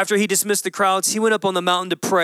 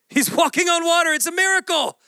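much higher, thrilled exclamation; amazed; 10 semitones higher than usual. Acted. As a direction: burst out in wonder at the miracle. Peter walking on water, amazed.

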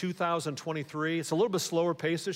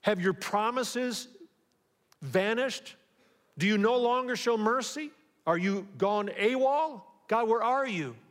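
pleading with God; 6 semitones higher than usual. Retold or imitated. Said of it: "You're like".

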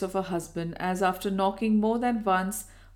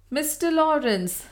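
high, loud call across the room; exclaimed; 8 semitones higher, 5 dB louder than usual. A narrator's reading. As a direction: call his name out brightly. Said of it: "she exclaimed".

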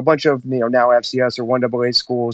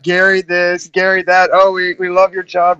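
lightly mimicked gushing parent, a little raised and loud; 4 semitones higher, 5 dB louder than usual. Teammate retelling parents' praise of his friend, mock gushing. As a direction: chatter on like an admiring parent.

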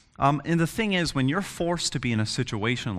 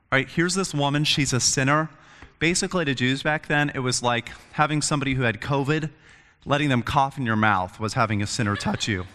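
ordinary delivery

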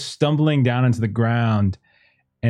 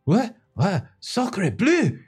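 put-on French accent; 8 semitones higher, much slower than usual. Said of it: "And he's like".

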